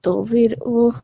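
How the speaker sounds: tearful voice; crying on the phone. Real, not acted. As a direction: speak through tears. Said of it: "रो मत".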